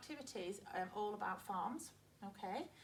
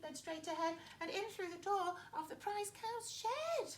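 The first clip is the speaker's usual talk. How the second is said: small ladybird voice, much higher; 11 semitones higher than usual. Acted. The ladybird warning the farm animals about the thieves, high small voice. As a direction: speak up high like a tiny bug. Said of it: "told the animals".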